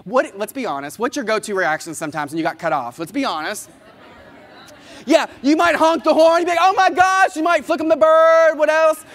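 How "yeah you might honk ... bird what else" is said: loud and high, hamming up a furious driver; mock road rage. Speaker to audience, acting out being cut off, loud mock fury. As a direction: blast it like you're fuming behind the wheel.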